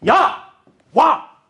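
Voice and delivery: put-on high, slow, drawn-out yell to the child; sharp yell. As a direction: yank the child back with a shout. 부모가 아이에게 소리침. (아이를 부르는 길게 끄는 높은 고함)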